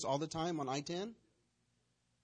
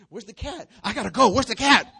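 much louder, higher yelling through laughter; frantic laughing yell; 7 semitones higher, 17 dB louder than usual. Retold or imitated. Dog owner Michael yelling about the cat, laughing. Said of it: "He goes".

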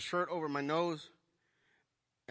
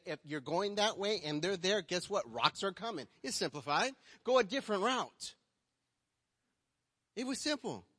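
preaching at full force; 6 semitones higher than usual. Real, not acted. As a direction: drive the point home to the church.

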